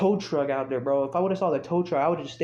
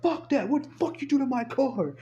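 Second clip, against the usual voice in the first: high-pitched defiant outburst; angry yelling; 13 semitones higher than usual. Retold or imitated. Owner defying the cops about his car, angry yelling.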